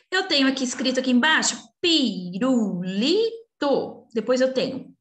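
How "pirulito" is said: "pirulito" slowly broken into pi-ru-li-to; a pause after each syllable of "pirulito". Shown as a demonstration. Teacher helping pupils find syllables, "pirulito" slowly syllable by syllable. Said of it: "falar devagarzinho pra vocês tentarem encontrar as sílabas".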